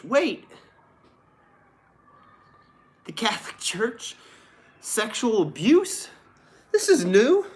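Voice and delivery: high, loud fake astonishment; mock shock. Streamer reading a church abuse headline, sarcastic mock shock. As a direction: gasp at the news as if it's a total surprise.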